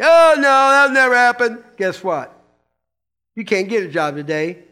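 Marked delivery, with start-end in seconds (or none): 0.0-1.6 high, loud mock kid's scoff. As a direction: mimic a kid brushing off dad's warning. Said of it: "kids' scoffing reply".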